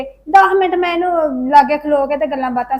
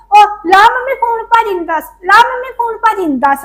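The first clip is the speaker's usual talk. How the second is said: put-on higher, louder nagging child voice; mimicking the daughter; 6 semitones higher, 4 dB louder than usual. Mother imitates her daughter urging her to phone uncle, higher and louder. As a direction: imitate the older girl pestering her mom. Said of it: "ਵੱਡੀ ਉਹ".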